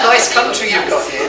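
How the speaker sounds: high, loud, laughing prim Englishman. Retold or imitated. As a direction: say it through giggles, primly polite. Mocking his own English politeness.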